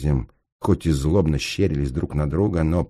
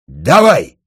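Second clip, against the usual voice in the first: loud, higher burst of breath; breathed-out command; 7 semitones higher, 13 dB louder than usual. A narrator's reading. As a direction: blurt the signal out forcefully. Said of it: "выдохнул будочник". (громко выдохнуть команду)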